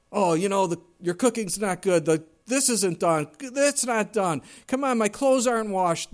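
hammed-up whiny husband griping; nagging complaint. Preacher playing a husband griping to his wife, loud mock nag.

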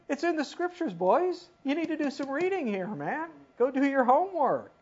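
high-pitched mock scolding of the boys; teasing scolding. Preacher chiding the leaders to read scripture, high and teasing. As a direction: needle them like a teacher chiding lazy students.

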